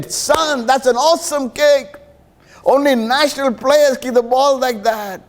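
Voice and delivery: high, delighted praise from a proud dad; proud father's praise. Retold or imitated. Father cheering his son's kick, high and delighted.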